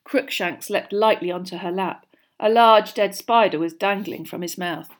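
usual speaking voice

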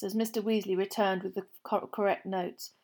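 ordinary delivery, nothing marked

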